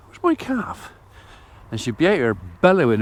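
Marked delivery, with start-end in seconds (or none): 0.1-0.9 high, mock-fretful cow calling for her calf; worried mother cow. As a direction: voice the cow, pitched way up and anxious. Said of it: "usually about midnight she goes".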